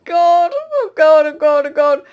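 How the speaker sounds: high, loud giggly panic; half-laughing terror. Real, not acted. Still chased, high and laughing in panic.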